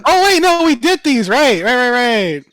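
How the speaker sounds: loud, very high outburst of remembering; sudden excited realization. Real, not acted. Game master realizing these were done, excited outburst. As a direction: cut in loudly as it clicks.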